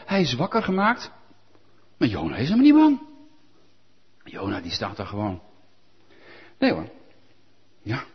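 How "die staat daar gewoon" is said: ordinary delivery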